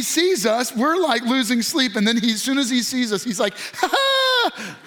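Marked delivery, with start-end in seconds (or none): 3.7-4.8 high, drawn-out "ha ha"; high-pitched mock baby laugh; imitated baby squeal. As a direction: mimic a baby delighted to see its parents. Imitates baby on seeing parents.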